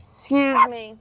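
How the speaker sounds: loud, sudden "excuse me"; loud outburst. Real, not acted. Passenger excusing herself after being blessed, loud outburst. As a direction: blurt it out loudly. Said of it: "[name] just blasted y'all eardrums".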